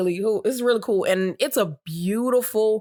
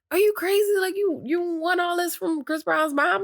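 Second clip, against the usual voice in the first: higher, disbelieving friends; incredulous; 11 semitones higher than usual. Retold or imitated. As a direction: ask in high disbelief. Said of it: "everybody's like".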